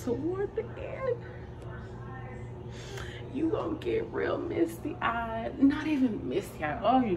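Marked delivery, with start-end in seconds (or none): 0.0-5.6 hushed whisper so others in the theater won't hear. Real, not acted. Vlogger whispering to viewers in an early theater. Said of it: "warning in a whisper".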